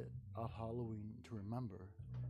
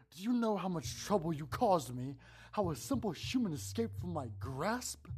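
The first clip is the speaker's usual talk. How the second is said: higher, louder raging monster; 5 semitones higher, 5 dB louder than usual. Acted. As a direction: rage at the victim. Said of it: "he raged".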